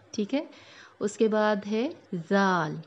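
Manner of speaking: usual speaking voice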